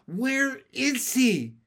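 high, slow, emphatic; urgent, drawn-out question; insistent demand. A narrator's reading. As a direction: demand it word by word. Narrator frog demands an answer.

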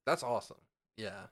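ordinary delivery

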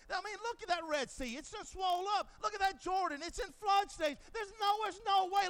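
wide-eyed disbelief, lightly acted; mock astonishment. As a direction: marvel at the swollen sea.

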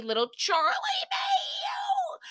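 hammed-up adoring cry for the cat; mock doting squeal. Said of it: "he basically said".

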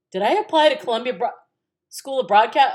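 loud, high alarmed question; retold panic. Daughter fearing wrong school, loudly panicking. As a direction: blurt it in mock panic.

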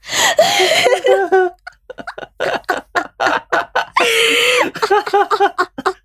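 burst of laughter. Real, not acted. Laughing at roommate's retort.

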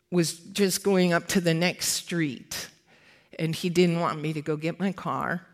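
ordinary talk

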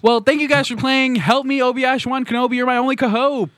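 high-pitched host outro; game-show sign-off. Acted.

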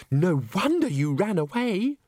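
high, slow character voice; wide-eyed sympathy. Acted. Christine sympathising with runaway Agnes, wide-eyed. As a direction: gush it, pitched well up.

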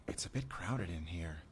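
hushed library whisper; breathy whisper among students. Acted. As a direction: keep it down so nobody hears. Quiet talk in a crowded library.

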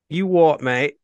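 a hammed-up Aussie taunt; bad Australian accent. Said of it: "I gotta practice my horrible impersonations".

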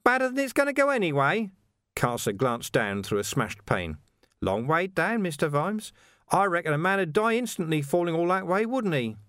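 high, chirpy villain with a laugh; mock innocence, grinning high; cheerful menace, high-pitched; Carcer's voice. A narrator's reading. Carcer taunting.

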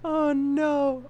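high sigh after a laughing fit; high-pitched dismay. Real, not acted. Podcaster recovering from Seuss slip, high-pitched dismay. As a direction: let it out high, spent from laughing.

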